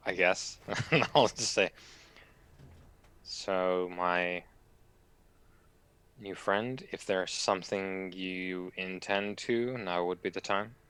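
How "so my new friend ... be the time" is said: quiet. Acted. Player speaks to the old man in character.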